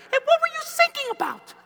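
put-on very high child's voice. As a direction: mimic a cheeky kid. Child mimics from back seat.

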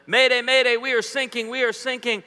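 high, loud mayday call; frantic distress call; panicked sailor. Retold or imitated. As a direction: yell it like a sinking sailor. Acting out distress call clip.

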